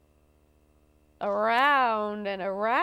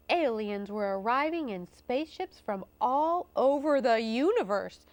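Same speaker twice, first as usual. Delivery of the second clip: ordinary delivery, nothing marked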